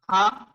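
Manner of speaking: Ha with a breath of H and open "aa"; Ha, held to show the H; H sound. Shown as a demonstration. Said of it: "H sound".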